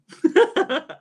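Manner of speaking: high bright laugh. Real, not acted. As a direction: burst out laughing.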